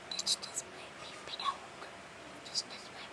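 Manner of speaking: hushed, voiceless whisper; barely audible playful catchphrase. Pretend. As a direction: whisper it very softly.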